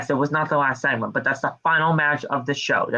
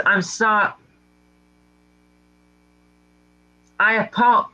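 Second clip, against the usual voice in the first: mock choked-up apology, higher; struggling to say sorry; halting apology; 6 semitones higher, slower than usual. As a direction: stop dead mid-word, can't get it out. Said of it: "keeps stopping mid-sentence".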